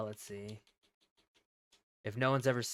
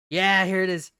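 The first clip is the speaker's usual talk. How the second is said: excited; 4 semitones higher, 8 dB louder than usual. Real, not acted. Excited at finding the scene.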